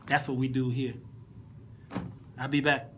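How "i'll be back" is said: usual speaking voice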